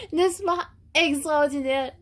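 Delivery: high, slow, laughing delight. Real, not acted. Vlogger thrilled about South Africa, high laughing delight. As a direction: savour the wonder, laughing.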